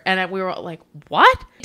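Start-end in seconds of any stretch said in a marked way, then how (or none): 1.1-1.5 a high, loud incredulous what; stunned what. Retold or imitated. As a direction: shoot it up in disbelief. Staff's stunned reaction retold.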